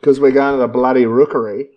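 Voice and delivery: put-on loud, broad Australian outer-east accent; mock Ringwood Aussie, punched out. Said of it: "my Ringwood accent".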